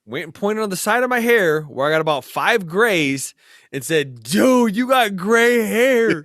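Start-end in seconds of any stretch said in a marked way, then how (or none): 4.2-6.2 higher and louder, laughing through a buddy's teasing; mock buddy voice. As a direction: tease like the mechanic who spotted grey hair. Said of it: "he goes like this".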